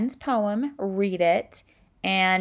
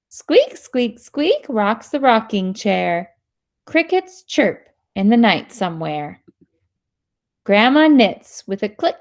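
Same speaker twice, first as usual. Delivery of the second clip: put-on louder, lively read-aloud voice for kids; reading to children; 8 dB louder than usual. Teacher reading a sound-word book to students, lively. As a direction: bring the story alive for the class.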